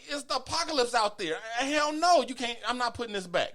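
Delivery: high, hammed-up defiance; comic re-enacted indignation; retold outraged refusal. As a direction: refuse loudly with the pitch way up.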